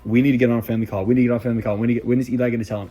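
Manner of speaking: rapid-fire retold nagging; insistent pestering. Mom and grandma pressing for a family call, insistent. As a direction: rattle it off, barely a gap between demands.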